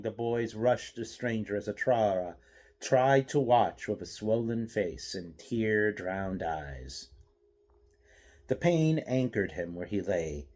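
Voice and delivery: ordinary delivery, nothing marked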